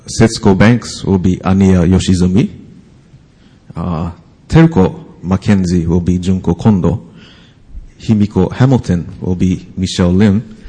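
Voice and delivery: ordinary talk, nothing marked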